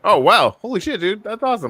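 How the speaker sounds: amazed, voice lifted high; impressed amazement. Real, not acted. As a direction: react as if genuinely blown away. Learns he used to fly Chinooks.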